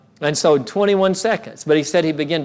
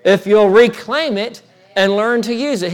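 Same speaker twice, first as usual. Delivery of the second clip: retold Lord's promise, raised and emphatic; strong, resounding; 5 semitones higher, 4 dB louder than usual. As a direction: press the condition home firmly. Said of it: "it resounded so strong".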